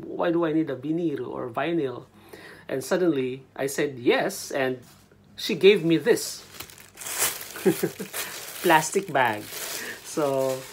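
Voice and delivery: ordinary delivery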